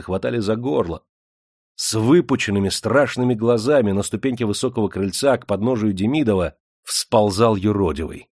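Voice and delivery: plain reading voice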